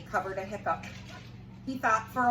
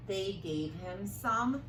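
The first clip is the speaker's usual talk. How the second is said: ordinary delivery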